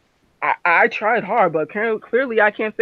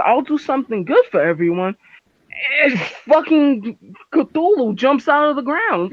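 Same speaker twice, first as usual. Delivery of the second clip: usual speaking voice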